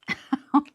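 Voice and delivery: a burst of bright, loud laughter; delighted laugh. Real, not acted. Crafter laughing at her cute treat tags, delighted laugh.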